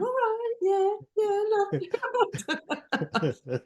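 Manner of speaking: high, silly character voice; put-on feeding voice. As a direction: do a high-pitched caricature of her. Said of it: "She doesn't even talk like that".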